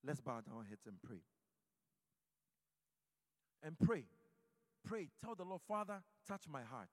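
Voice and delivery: fervent call-and-response prayer; leading prayer. Acted. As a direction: pray aloud for the congregation, building. Leads congregation in prayer.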